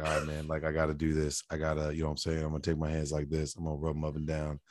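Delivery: put-on low, soft, casual older man's patter. Airport searcher explaining a pat-down to a traveler, low and quiet.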